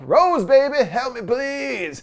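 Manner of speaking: acted feeble, drawn-out plea; raspy call for help. A narrator's reading. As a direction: plead for help, drawing out the last words. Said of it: "breathing raspily and calls for help".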